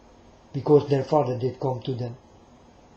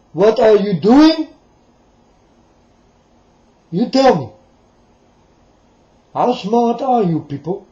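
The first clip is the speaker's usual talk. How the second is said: loud, higher, heated shouting; real shouting rant; 4 semitones higher, 9 dB louder than usual. Real, not acted. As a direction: yell it at the crowd. Ranting at the audience about insults.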